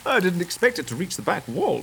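high squealing laughter; surprised laughter. Acted. The friend reacts as the champagne sprays the room, laughing. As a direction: shriek as the bottle sprays.

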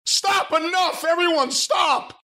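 high-pitched mock shout, drawn out at the end; hammed-up plea to stop a brawl; Jericho breaking up a fight. Wrestler runs into the middle of a fight, mock high shout. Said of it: "Jericho runs in".

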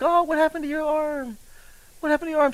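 high, soft, concerned voice; caring chiropractor. Retold or imitated. Young chiropractor asking grocery lady about her arm, high and soft. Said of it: "I'm like".

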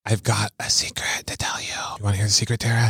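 soft secret whisper. Real, not acted. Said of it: "You're still whispering".

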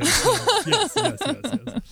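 high squealed yeses; gleeful repeated yes; excited. Real, not acted. Excited agreement, high pitched.

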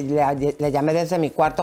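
usual speaking voice

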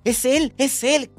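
high giddy squeal, Es él said slowly; excited squeal. Retold or imitated. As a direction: squeal Es él like a smitten teen. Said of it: "que sentíamos eso".

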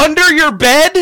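loud, high incredulous question; surprised. Real, not acted. Reacts to portal under bed.

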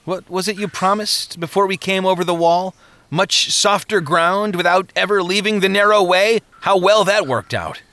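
sarcastic jab; sneering. Acted. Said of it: "Hopeful sneered".

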